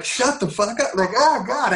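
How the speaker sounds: high-pitched incredulous outburst; retold disbelief. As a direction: react to praise in squeaky mock disbelief. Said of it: "I'm like".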